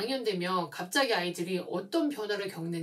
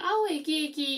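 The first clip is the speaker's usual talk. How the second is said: put-on doting baby talk; 7 semitones higher than usual. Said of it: "이렇게 하고".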